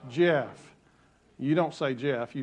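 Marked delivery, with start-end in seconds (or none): 0.1-0.8 slow, measured lead for others to join; deliberate congregational prompt, drawn out; leading a recitation. Shown as a demonstration. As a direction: spell it out slowly, word by word. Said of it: "Say it with me".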